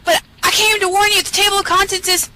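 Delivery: high, frantic voice; urgent warning. Acted. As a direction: rush to warn them in high alarm. Narrator urgently warns the chicken.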